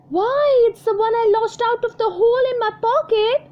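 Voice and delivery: high, loud burst of delight; a little girl thrilled to find her lost coin; joyful cry. Acted. Jenny recognizes her lost twenty pence, high joyful cry. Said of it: "cried Jenny joyfully".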